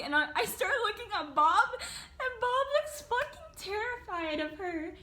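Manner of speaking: high voice breaking into laughter; can barely get the words out for laughing; giggling at how terrified Bob looked; laughing through words. Real, not acted. Cracking up at scared friend.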